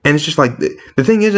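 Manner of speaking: ordinary delivery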